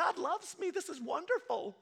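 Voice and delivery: high, bright mock joy; mock delight. Churchgoer thanking God for parking, mock delight. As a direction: gush like you just got lucky.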